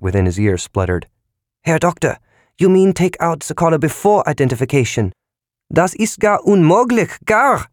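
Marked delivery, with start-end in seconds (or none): 1.6-7.7 much higher, startled German voice; aghast protest in German accent; shocked German aide. A narrator's reading.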